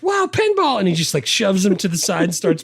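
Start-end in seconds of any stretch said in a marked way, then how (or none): 0.0-0.8 put-on high excited kid voice; excited Henry. Retelling Henry spotting pinball in a high voice. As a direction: squeal it out like a thrilled kid. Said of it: "He's like".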